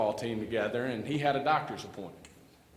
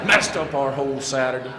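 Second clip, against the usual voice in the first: louder, exasperated complaint; mock complaint; 3 semitones higher, 9 dB louder than usual. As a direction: blame your buddy, half-laughing. Complaining to friend while raking.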